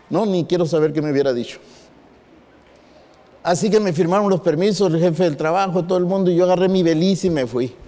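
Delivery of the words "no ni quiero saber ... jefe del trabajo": ordinary delivery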